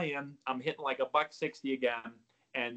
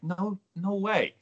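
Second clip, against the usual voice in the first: amazed disbelief; 7 semitones higher, much slower than usual. Retold or imitated. Disbelief at news.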